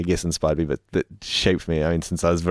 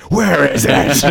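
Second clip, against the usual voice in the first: demanding boss; 8 semitones higher, 7 dB louder, slower than usual. Pretend. Mock boss demanding the program.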